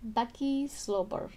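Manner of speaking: slow "Bakkie slobber." in a mock Russian accent; slow, heavy Russian-accented "Bakkie slobber.". Shown as a demonstration. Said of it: "now I sound Russian".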